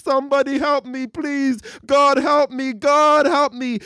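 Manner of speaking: high, loud desperate cries for help; panicked screaming. Acted. Atheist to anyone, screaming in panic for help. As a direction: scream for your life as the bear closes in.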